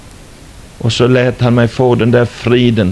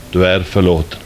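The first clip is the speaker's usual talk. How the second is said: much lower, calm and gentle; voice of God; 7 semitones lower than usual. Retold or imitated. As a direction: speak it as God, deep and forgiving. Voice of God speaking. (Guds röst, låg och mild)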